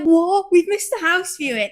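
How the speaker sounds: high, loud startled panic; panicking. Retold or imitated. Woken at night, repeating his panic loudly. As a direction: jolt awake in alarm. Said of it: "I don't know why I was panicking".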